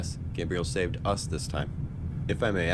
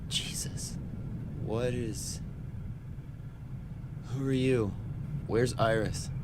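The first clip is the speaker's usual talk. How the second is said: whispered, then soft and higher; groggy waking; 5 semitones higher, 6 dB quieter, slower than usual. Acted. Patient wakes to a stranger after surgery, groggy. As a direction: come round confused and weak.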